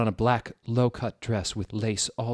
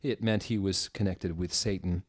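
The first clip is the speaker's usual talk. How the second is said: usual speaking voice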